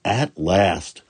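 ordinary delivery

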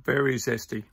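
speaking between bites; talking while chewing. Real, not acted. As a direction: mouth partly full of fruit. Seen 2: Bit mango at -5 to -3, mouth closed chewing, then speaks holding mango.

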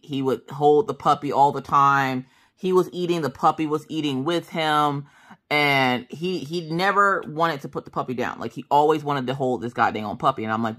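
ordinary talk

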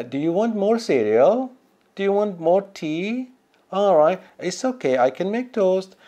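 a clumsy Scottish landlady offering breakfast; Scottish accent. Retold or imitated. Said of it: "So I'm trying to do a Scottish accent".

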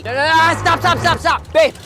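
urgent yelled stop stop stop; yelling. Acted. Yelling backing up trailer.

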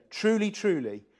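solemn, ringing "Truly, truly"; emphatic truly truly. Shown as a demonstration. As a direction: lean hard on both "truly"s. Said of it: "that word again".

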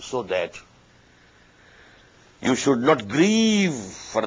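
very slow, deliberate counsel; slow, solemn. Acted. Preacher counselling patience in loss, slow and grave. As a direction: stretch every word out gravely.

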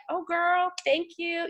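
put-on high-pitched, flattered girlfriend voice; touched thanks. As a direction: coo a grateful reply, acted. Voicing Britt's pleased reply.

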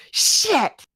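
put-on high little-kid "Shit" dropped on a fall; kid's blurted swear. Niece dropping something, blurting a swear like a kid. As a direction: blurt it like an eight-year-old. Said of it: "they'd drop something and go".

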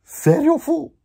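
words pushed up into a giggle; laughing through it. Retold or imitated. Quoting himself to the cellmate, laughing high. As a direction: squeak it out high mid-laugh.

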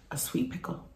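usual speaking voice

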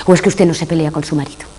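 ordinary talk

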